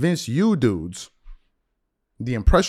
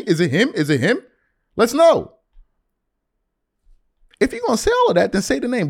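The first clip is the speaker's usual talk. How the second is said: imitated heated challenge, pitched high; Kenyon Martin; 10 semitones higher, 4 dB louder than usual. Player confronting a trash talker on the court, indignant and high. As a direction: fire off indignant demands in a raised voice. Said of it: "Kenyon Martin was like".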